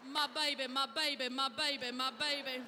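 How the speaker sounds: put-on loud, repeated cry of my baby; crying out tenderly. Husband holding his weeping wife, loud tender cry. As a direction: embrace her with your voice, calling out.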